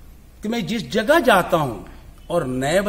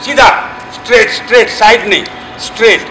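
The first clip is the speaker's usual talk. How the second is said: louder, commanding dance-teacher voice; loud class instruction; 6 semitones higher, 8 dB louder than usual. Real, not acted. Chhau teacher instructing students on sword and stance, loud and commanding. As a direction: project to the whole hall as you correct them.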